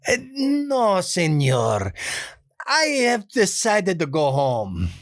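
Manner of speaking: put-on high, slow pleading in a Spanish accent; a nice guy pleading in a Spanish accent. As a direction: beg off sweetly in a Spanish accent. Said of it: "that sort of pleading quality".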